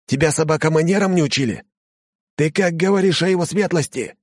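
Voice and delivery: high indignant scolding; outraged servant. Acted.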